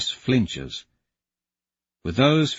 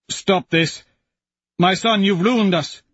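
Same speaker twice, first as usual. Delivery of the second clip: much higher, louder desperate plea; desperate outcry; 9 semitones higher, 4 dB louder than usual. Acted. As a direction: beg him to stop, panicked. Father pleading in panic.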